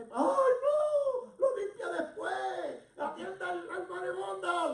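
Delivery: loud, high-pitched acted cry; desperate wail. Daughter begging rabbi to attend the dying, desperate wail. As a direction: wail in hammed-up desperation, stretched out.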